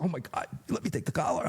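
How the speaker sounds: quick, high, breathless eagerness; eager young cop. Retold or imitated. Young cop begging to take the arrest, eager and fast. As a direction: rattle it off like a thrilled rookie.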